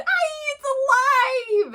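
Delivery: high, loud, drawn-out shriek of horror; hammed-up panicked wail; scream. A narrator's reading. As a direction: scream as if a doll came to life. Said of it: "The man screamed".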